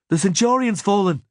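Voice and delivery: ordinary delivery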